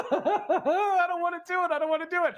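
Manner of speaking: high-pitched, through real laughter; laughing protest. Real, not acted. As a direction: laugh while begging off. Laughing, reluctant to end the auction.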